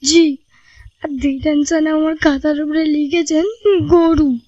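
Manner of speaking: put-on tearful boy. A narrator's reading. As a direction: complain tearfully like a wronged boy. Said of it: "ফোঁপাতে ফোঁপাতে বলল". (কাঁদো কাঁদো ছেলের গলা)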